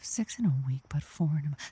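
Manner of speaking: quietly repeating it, lost in thought; muttering to herself. Real, not acted. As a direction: mull the riddle under her breath.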